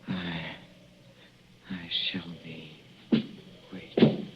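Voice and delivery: low-volume sinister whisper; menacing whisper. Acted. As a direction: whisper it to her like a curse from the grave. Dying man's last whispered words.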